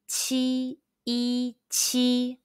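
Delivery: "Qi, e, qi" each high and level; syllables spelled out then joined on a high level tone; first tone qi. Shown as a demonstration. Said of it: "high-pitched first tone all together".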